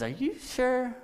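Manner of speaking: a doubting priest's disbelief; incredulous question. Retold or imitated. Zechariah doubts the angel.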